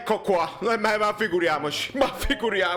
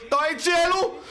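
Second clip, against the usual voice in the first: excited shouting; 10 semitones higher than usual. Real, not acted. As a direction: yell it like a last-minute winner. Fan screaming at a goal.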